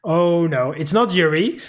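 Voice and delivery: ordinary talk, nothing marked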